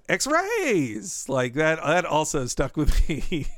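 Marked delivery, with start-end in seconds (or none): 0.1-1.3 X-rays said high with a stretched, swooping RAYS; Azaria's X-rays. Retold or imitated. Quoting a quack doctor's favourite line, X-rays drawn out high. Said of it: "the way Azari says".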